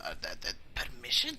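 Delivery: thrown, stuttering question; flustered stammer. Acted. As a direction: stumble over it, then question the word. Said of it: "And Snickers goes".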